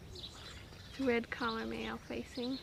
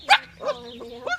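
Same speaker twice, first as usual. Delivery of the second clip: much higher, louder puppy talk; squeaky praising a dog; 11 semitones higher, 14 dB louder than usual. Real, not acted. Talking to the puppy.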